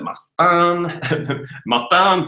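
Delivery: put-on name said with a playful French accent. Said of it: "'cause I'm French".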